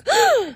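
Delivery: exaggerated gasp of fake shock; mock shocked gasp. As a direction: gasp as if totally astonished. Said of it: "acting all shocked".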